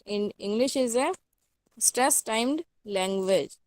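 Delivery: each word spelled out slowly for note-taking; slow dictation. Shown as a demonstration. Teacher slowly dictating a definition for students to copy.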